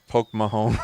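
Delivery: giggling out the phrase; laughing quote. Retold or imitated. Quoting a friend's phrase while laughing.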